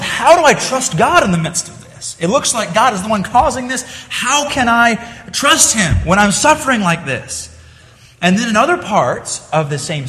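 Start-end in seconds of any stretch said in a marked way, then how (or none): 0.0-7.5 pleading psalmist. Retold or imitated. Psalmist's inner struggle voiced.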